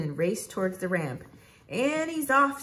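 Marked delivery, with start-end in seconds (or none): 1.7-2.6 high, drawn-out race-start call; announcer. A narrator's reading. Announcer calls the stunt run's start, high and excited. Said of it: "said the announcer".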